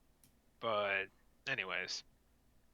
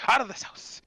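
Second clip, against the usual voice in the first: mock exorcism; 10 semitones higher than usual. Host joins the mock exorcism.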